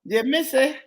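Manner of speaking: put-on Jamaican voice, pitched high; high mock Jamaican lilt; high-pitched Jamaican accent. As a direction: keep the Jamaican caricature up high. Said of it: "when I do my Jamaican accent".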